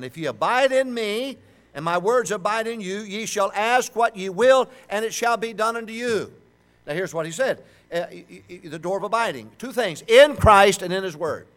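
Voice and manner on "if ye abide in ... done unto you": high, loud preaching of the verse; scripture reading. Acted.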